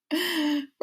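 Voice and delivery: sharp high gasp; tearful gasp as she calls her brother. Retold or imitated. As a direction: gasp in before the news. Crying call to brother.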